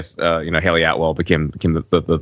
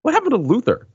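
sudden high-pitched wait-what realization; squeaky mock disbelief at a plot hole; confused surprise; faster than usual. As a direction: voice jumping way up in bafflement. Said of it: "I was like".

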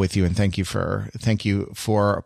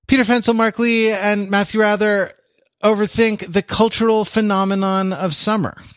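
slight announcer voice; 9 semitones higher than usual. Shown as a demonstration. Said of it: "the slight announcer voice that I do for the, the titles".

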